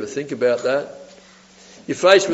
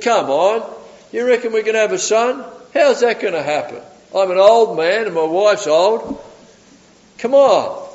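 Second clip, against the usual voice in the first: higher, scoffing disbelief; incredulous doubt; 5 semitones higher than usual. Retold or imitated. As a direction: protest as if it's absurd, pitch lifted. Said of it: "he responds in doubt".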